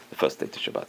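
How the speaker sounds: hushed, voiceless counting; whispered. Shown as a demonstration. Teacher whispering the days to Shabbat to the class. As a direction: whisper the count under your breath.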